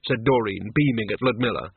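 usual speaking voice

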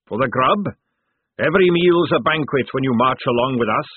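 loud, booming fat sergeant; hearty sergeant. Acted. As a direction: boom it like a jovial recruiter. Sergeant pitch.

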